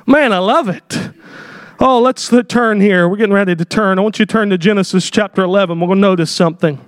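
ordinary delivery

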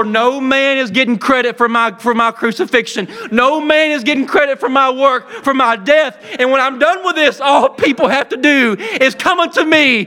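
full-force preaching with shouts. Acted. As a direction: preach it out to the congregation, building.

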